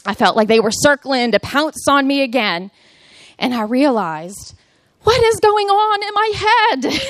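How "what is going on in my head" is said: much higher-pitched incredulous outburst; high, exasperated mock cry; exclaimed self-questioning. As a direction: act out exclaiming at your own thoughts. Acting out her sudden realization.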